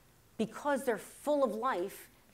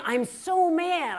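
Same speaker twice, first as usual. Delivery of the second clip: slow, higher, louder comic fuming; mock anger; 7 semitones higher, 5 dB louder, much slower than usual. Mother complaining to daughter, slow mock anger. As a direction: stretch out mom's complaint, mock mad. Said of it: "she is saying".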